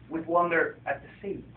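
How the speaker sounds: gentle picture-book reading voice; story narration. A narrator's reading. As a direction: read it like a bedtime story to children. Author reads his picture book.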